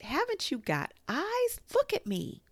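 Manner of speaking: very high, loud squeal of protest; little creature shouting indignantly; shouted. A narrator's reading. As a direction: shout it shrilly, demanding attention. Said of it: "shouted Piglet".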